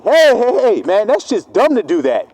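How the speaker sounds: mock alarmed shout; loud urgent warning. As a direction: shout him away from the snake. Third, most urgent warning.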